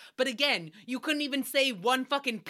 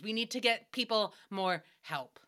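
normal talking voice

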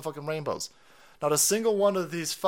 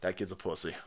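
dismissive dad; 8 semitones lower, 9 dB quieter than usual. Retold or imitated. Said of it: "is sitting there going".

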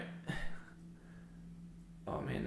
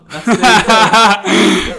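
laughing; 6 semitones higher, 15 dB louder, much slower than usual. Real, not acted. Laughing rap banter.

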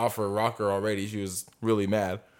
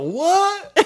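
very high, loud what; shouted what; 6 dB louder than usual. Retold or imitated. Coworker over the loud motor, high mock shout. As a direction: act out someone straining to hear over noise.

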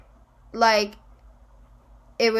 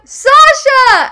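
high, loud mistaken hail; imitating a friend's excited yell; retold shouted greeting; 14 semitones higher, 12 dB louder than usual. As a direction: holler the name back from down the hill. Friend shouts back wrong name.

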